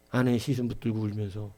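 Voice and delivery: ordinary talk, nothing marked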